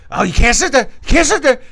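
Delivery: high, loud retold shout; hammed-up drunk yelling; mock yelled warning. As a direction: bark it like a drunk friend. OG stops her from sitting.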